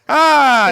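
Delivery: badly timed fake yelp; mock cry. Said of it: "me going like".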